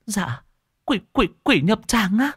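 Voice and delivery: very high, stammering terror; terrified. Acted. Wife asking the monk about the demon, terrified and high. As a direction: gasp out the word demon in fright. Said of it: "Bà Thơm kinh hãi".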